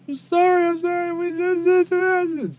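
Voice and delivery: high-pitched, loud frantic begging; grovelling. Acted. Game master voices the kid begging for mercy, grovelling. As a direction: blurt it out in a high, pleading wail. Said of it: "fall down to his knees and grovel".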